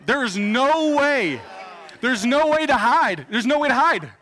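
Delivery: loud, high comic alarm; exclaimed mock dismay. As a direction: cry out in exaggerated panic for the goalie. Horror at goalkeeper's exposed position.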